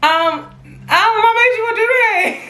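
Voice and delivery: squeaky, rushed nervous asking; high rushed mock-awkward question; high nervous quote. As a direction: blurt it out high and fast like a nervous girl. Retelling her own awkward question.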